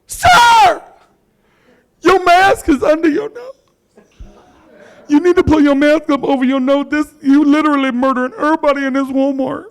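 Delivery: mock yelling. Comic imitating a young shopper scolding a man's mask, high loud yell. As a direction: yell like a scandalized Walmart shopper. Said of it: "some little under thirty something yelling".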